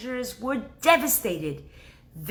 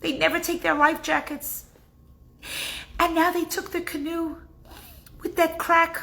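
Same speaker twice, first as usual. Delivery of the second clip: frantic scream; 10 semitones higher than usual. Acted. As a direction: cry out in terror for the grandsons. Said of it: "he starts to scream".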